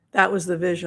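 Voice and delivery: ordinary delivery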